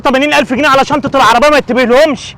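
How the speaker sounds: loud, high berating; angry scolding. Acted. Father berating daughter over lost money, loud. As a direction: tear into her carelessness.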